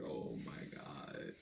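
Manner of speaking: a drawn-out, sinking "oh my God"; exasperated groan. Retold or imitated. Reacting to mom reading the texts aloud, sinking exasperation. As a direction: drop low and slow, dreading it.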